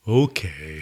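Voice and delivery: low, reluctant okay; grudging dog. Retold or imitated. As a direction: sigh it out like a bored old dog. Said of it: "He goes".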